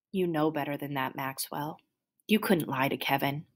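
soft, hushed reassurance; gentle correction. Acted. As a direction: set the upset child straight, softly. Doctor gently corrects grieving boy.

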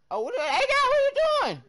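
sudden high yelp of surprise; startled cry at the screen; shocked outburst. Real, not acted. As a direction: blurt it out, caught off guard. Sudden shock at teammate's play.

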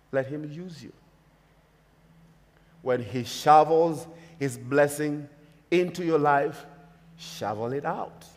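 ordinary delivery

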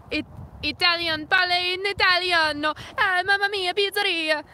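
high, loud hammed-up Italian; mock Italian accent. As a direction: belt out food words in a caricature Italian accent. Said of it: "makes fun of Italian accent".

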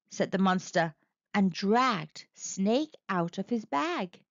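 usual speaking voice

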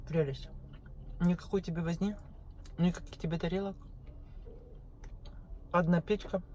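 ordinary talk

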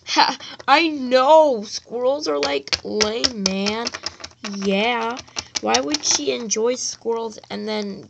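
loud, low, sneering laugh-talk; lightly voiced mean kid; mocking bully voice. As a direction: jeer like a playground bully. Mean kids mocking a classmate.

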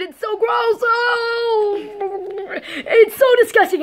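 high, disgusted wail. Real, not acted. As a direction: gag over the taste. Reacts to the pancake's taste.

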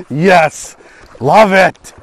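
loud happy shout of joy; excited cheer. Real, not acted. Excited catch celebration.

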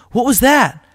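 sudden high alarmed question; startled girl hearing a noise in the dark. A narrator's reading.

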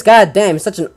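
ordinary talk, nothing marked

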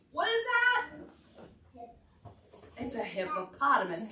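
ordinary talk

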